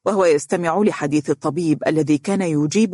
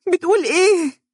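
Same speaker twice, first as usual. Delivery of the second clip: high, louder shocked scream; 14 semitones higher, 4 dB louder than usual. Acted. Dunya repeating her question, screaming in shock. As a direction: cry out in shock. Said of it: "هتفت دنيا صارخة".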